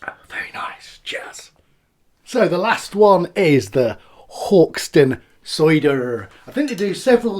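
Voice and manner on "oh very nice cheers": breathy whisper after the sip. Real, not acted. Reviewer whispering to viewers after tasting, hushed. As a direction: whisper it to yourself, savouring.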